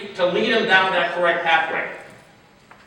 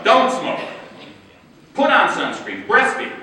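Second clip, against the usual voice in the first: higher and louder, curt mock commands; mock bossy orders; 7 semitones higher, 5 dB louder than usual. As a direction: bark the rules like a nagging authority. Mocking orders people dislike hearing.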